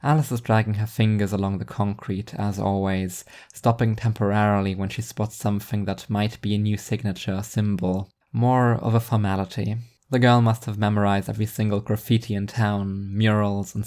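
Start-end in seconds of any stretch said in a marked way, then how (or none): none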